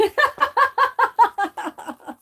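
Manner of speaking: shrieking laugh that falls away; high squealing laugh. Real, not acted. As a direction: lose it laughing. Laughing hard.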